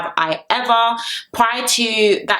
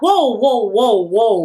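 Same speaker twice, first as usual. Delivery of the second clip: taken aback; 3 semitones higher, 3 dB louder, much slower than usual. Retold or imitated. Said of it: "Joey was just like".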